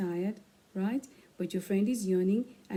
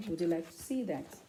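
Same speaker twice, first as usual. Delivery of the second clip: plain reading voice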